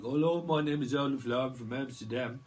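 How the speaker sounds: badly faked Dutch accent; bad Amsterdam accent. Joking reader introduces Olaf from Amsterdam, bad Dutch accent. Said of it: "That's a bad accent".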